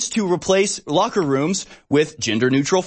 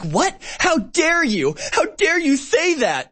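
much higher, hammed-up indignant squeal; mock outrage; 12 semitones higher, slower than usual. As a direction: parody the offended sergeant, pitch way up. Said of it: "He's like".

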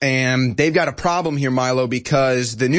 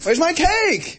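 mock whine; much slower than usual. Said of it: "Is that better?".

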